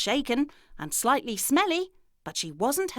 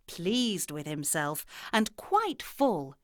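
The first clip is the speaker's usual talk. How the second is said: ordinary delivery, nothing marked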